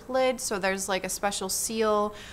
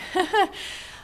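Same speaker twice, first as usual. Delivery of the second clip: short laugh; 10 semitones higher than usual. Real, not acted. Laughs at her own hedge.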